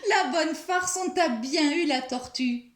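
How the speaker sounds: high cackle, then loud gleeful gloating; bursting with laughter. A narrator's reading. Three friends crowing over the tricked tortoise, bursting with laughter. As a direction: laugh high and crow it loudly. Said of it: "éclatent de rire".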